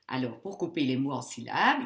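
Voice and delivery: ordinary talk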